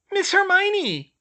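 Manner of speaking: very high, slow squeal of delight; a tiny elf squeals a happy greeting; squealing house-elf. A narrator's reading. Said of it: "a squeal came from one mop-wielding elf".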